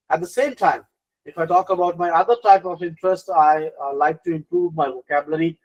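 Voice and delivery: interview answer full of conviction; assertive. Shown as a demonstration. Candidate telling interviewer about passions, assertive. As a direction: answer firmly, sure of yourself. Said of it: "assertive tone, full of conviction".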